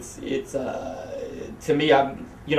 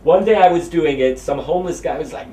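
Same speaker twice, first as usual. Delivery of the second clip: normal talking voice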